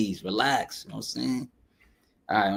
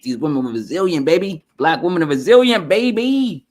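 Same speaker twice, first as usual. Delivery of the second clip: hyped praise; 6 semitones higher, 6 dB louder than usual. Real, not acted. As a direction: build the pride until it peaks up high.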